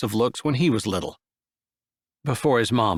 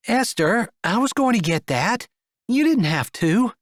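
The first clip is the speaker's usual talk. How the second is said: put-on higher, lively young woman; 9 semitones higher than usual. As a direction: lift into her light voice. Female character voiced.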